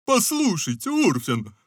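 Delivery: lightly voiced straw ruler; a hint of Scarecrow. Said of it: "спросил Страшила". (лёгкий голос Страшилы)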